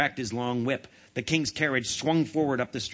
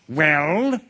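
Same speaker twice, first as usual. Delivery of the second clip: louder, sharply rising royal demand; 3 semitones higher, 7 dB louder than usual. A narrator's reading. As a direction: demand an answer loudly. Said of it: "he demanded".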